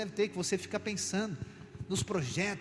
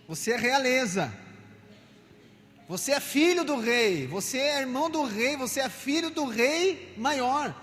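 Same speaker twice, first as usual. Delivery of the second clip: emphatic preaching; 4 semitones higher, 3 dB louder than usual. Real, not acted. Preacher declaring listeners children of the king, emphatic proclamation. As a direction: declare it boldly over the congregation.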